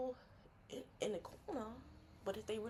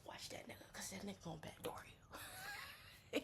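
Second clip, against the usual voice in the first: whisper; 3 dB quieter than usual. Pretend. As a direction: lean in and whisper the warning. Secret warning to a friend.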